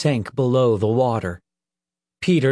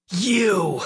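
high angry snarl; 8 semitones higher than usual. A narrator's reading. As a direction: spit it out in fury. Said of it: "he snarled".

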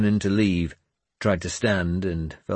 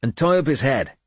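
firm, higher-pitched command; ordering; 7 semitones higher, 4 dB louder than usual. A narrator's reading. Finan tells the girl to bind his head, commanding. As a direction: give a brisk, firm order. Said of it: "Finan ordered her".

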